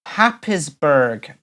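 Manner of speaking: Happisburgh, slow and unsure; slow careful try at Happisburgh; attempt at pronunciation. Shown as a demonstration. Host taking a viewer's challenge, slow. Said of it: "Well, I tried it".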